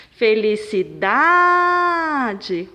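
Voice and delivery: Felicidade with DA drawn out very long and loud; felici-DAAA-de, sustained on da; stressed syllable exaggerated. Shown as a demonstration. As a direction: hold the da of Felicidade like a call. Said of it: "O dá é mais forte".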